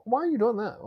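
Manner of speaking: high-pitched mock of a nosy girlfriend; mocking the little questions women ask, pitched high; mocking a nosy woman. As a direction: squeak out her prying question. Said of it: "women will ask those little questions, like".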